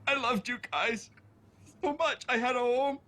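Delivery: tearful apology broken by pauses; crying. Acted. Apologizing to George, missing the old home, crying. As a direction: choke it out through tears. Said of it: "I'm sorry you're crying".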